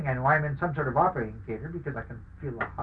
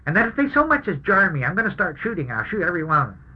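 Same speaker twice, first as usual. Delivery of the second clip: raised, commanding threat; loud; 4 semitones higher, 7 dB louder than usual. Retold or imitated. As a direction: bark the order, loudly. Wounded soldier shouting threats.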